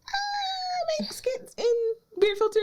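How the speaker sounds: very high, hesitant mock answer; imitated starstruck awkwardness; nervous squeak. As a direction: squeak it out as an unsure question. Acted nervous answer about herself.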